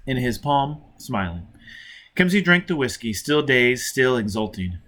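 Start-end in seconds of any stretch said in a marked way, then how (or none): none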